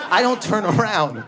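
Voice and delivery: ordinary delivery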